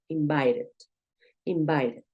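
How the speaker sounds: "Invited" with a tapped R-like T; T sounded like R. Shown as a demonstration. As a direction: say "invited" with an R for the middle T. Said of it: "sonar como una R".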